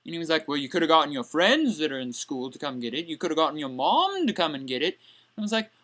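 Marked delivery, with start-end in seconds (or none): 0.5-5.0 low, softer mock teacher voice; unsympathetic teacher. Teacher telling student who could have fetched the homework, low and unsympathetic. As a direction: lightly imitate a teacher, pitched down.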